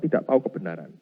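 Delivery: normal talking voice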